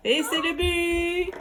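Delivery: excited sports commentary. Acted. Calling a goal in table hockey.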